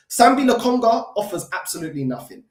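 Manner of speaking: ordinary talk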